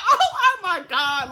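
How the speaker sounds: breathless laugh then a loud oh my God. Real, not acted. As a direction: laugh it out in disbelief. Laughing at the story.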